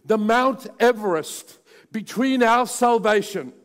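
loud, high, impassioned preacher; preaching at full force. Acted. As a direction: thunder it out to the congregation.

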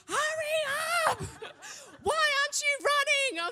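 high-pitched, urgent yell across the terminal; shouting at husband to hurry for the plane, high frantic yell. Retold or imitated. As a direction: scream at him to hurry, half-laughing. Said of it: "So I'm shouting at him".

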